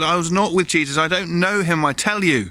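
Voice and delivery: loud, high, insistent denial; acted furious protest, hammering each phrase; shouted oath. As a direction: swear it at full volume. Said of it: "He called down oaths and swear words".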